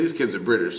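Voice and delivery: ordinary delivery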